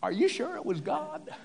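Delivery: high-pitched mock wife voice; doubtful wife. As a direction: question him in a high, incredulous female voice. Voicing Sarah doubting Abraham.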